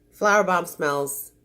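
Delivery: normal talking voice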